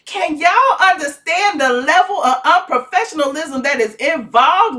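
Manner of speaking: excited gossip. Retold or imitated. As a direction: relish the drama, pitch up. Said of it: "I'm sitting there going".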